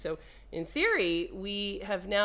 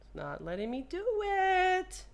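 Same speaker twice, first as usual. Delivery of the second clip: whiny; 11 semitones higher than usual. Real, not acted. Whiny complaint.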